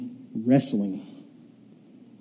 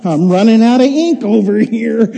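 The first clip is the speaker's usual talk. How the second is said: higher, louder joking outburst; joking protest, pitched up and loud; mock exclamation; 6 semitones higher, 6 dB louder than usual. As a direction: call out in comic overwhelm, laughing. Voicing congregation's joking response.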